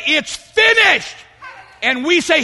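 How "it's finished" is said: high, slow declaration of finished; solemn cry, It's finished; Christ's declaration. Acted. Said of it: "He said".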